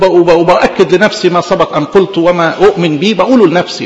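ordinary talk, nothing marked

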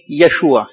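يشوع with sh in the middle; Hebrew pronunciation. Shown as a demonstration. Preacher answering how the name is pronounced, يشوع with SH. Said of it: "يتنطق اسمه إيه؟".

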